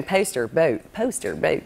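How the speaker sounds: Philly O in "Poster, boat"; Philadelphia accent. Shown as a demonstration. Guest shows her way-in words, Philadelphia accent. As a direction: practise Philadelphia vowels on each word. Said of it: "I was walking around the set going".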